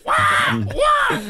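high squealing fake cry; mock baby wail. Dad imitating his crying baby, high hammed-up wail.

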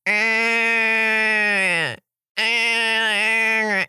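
put-on high, strained eh eh of a stuck child; high strained mock grunt; whiny kid grunt. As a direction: grunt like a kid tugging at a wrapper. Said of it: "you tug and pull at it and you go".

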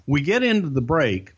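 ordinary talk, nothing marked

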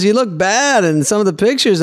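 high-pitched, slightly German waiter voice; dubious German accent. Retold or imitated. Waiter teasing a regular about pool photos, high off-target German accent. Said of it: "Slightly, slightly Germany".

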